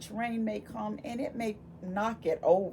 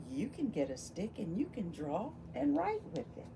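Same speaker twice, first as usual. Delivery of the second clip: ordinary delivery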